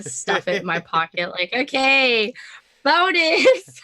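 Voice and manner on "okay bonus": higher, loud acted-out glee; gleeful. Player acts out pocketing the signed contract, gleeful. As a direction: crow it loudly, pitch climbing.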